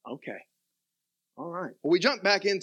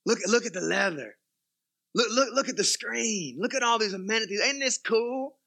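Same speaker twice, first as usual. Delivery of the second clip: showing off new car; 2 semitones higher than usual. Retold or imitated. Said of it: "we say".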